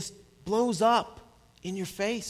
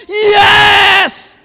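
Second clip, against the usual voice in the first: very high, very loud triumphant yell; child yelling over packing cardboard, ecstatic shout; child's joyful yell; 14 semitones higher, 16 dB louder than usual. Retold or imitated. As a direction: scream it like a kid gone wild with delight. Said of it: "They go".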